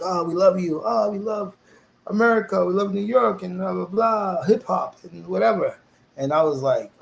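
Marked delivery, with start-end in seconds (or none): 0.0-5.8 a touch higher, lightly gushing mimicry; half-mocking the sweet talk. Everyone at school fawning over him, lightly mocked gushing.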